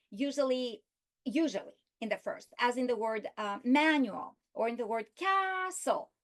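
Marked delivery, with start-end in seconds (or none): 3.6-4.4 MANUAL with the first syllable stretched and raised; stress on first syllable. Shown as a demonstration. Teacher showing students first-syllable stress, MAN stressed in "manual". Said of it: "include stress on the first". 5.2-6.1 CAS in "castle" held long and high; stress on first syllable. Shown as a demonstration. Teacher showing students pitch in a word, CAS stressed in "castle". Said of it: "include stress on the first".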